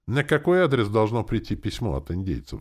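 ordinary delivery

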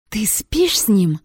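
slow, mildly venomous question; hissed accusation. A narrator's reading. Jealous attacker questions her rival, a touch of hiss. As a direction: draw out a suspicious accusation. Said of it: "прошипела светловолосая Мегера".

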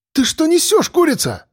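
high, sharp indignant snap; indignant outburst. A narrator's reading. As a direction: flare up at her, voice jumping high. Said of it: "вскинулся парень". (возмущённый выкрик, голос резко вверх)

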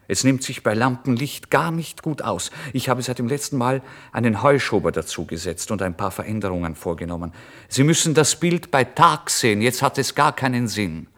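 fretful painter objecting loudly; agitated protest. Acted. Painter fends off late visitor.

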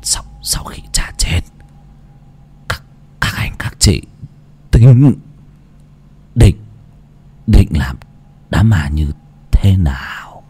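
laboured dying whisper, broken and halting; khó nhọc thì thầm. Acted. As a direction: gasp it out in pieces. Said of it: "khó nhọc". (thì thầm khó nhọc của ông già sắp chết)

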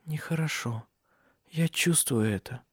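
very soft, faint murmur; half-conscious. A narrator's reading. Cecilia sensing something is wrong, half-conscious murmur. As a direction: let it fade out weakly, barely awake. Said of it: "в полузабытии произнесла Сесилия".

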